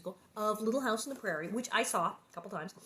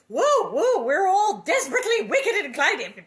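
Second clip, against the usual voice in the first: preachy Mary; 8 semitones higher, 13 dB louder than usual. Retold or imitated. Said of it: "Mary, chill".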